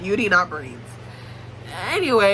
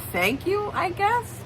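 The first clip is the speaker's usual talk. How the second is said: higher, rising, hesitant thanks; uncertain thanks; 9 semitones higher, 4 dB quieter, slower than usual. Acted. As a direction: imitate someone baffled but polite. Acted puzzled thanks for camera.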